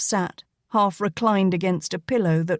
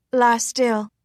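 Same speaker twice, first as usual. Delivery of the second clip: firm command; 4 semitones higher than usual. A narrator's reading. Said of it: "she commanded".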